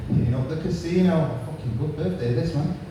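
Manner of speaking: normal talking voice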